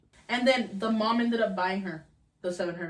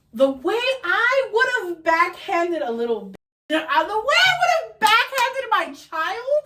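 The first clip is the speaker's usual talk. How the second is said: high, loud, slow outrage; high-pitched outraged cry, dragged out; 12 semitones higher, 8 dB louder, slower than usual. Real, not acted. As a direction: rant about backhanding her, slow and loud. Outraged at the little girl.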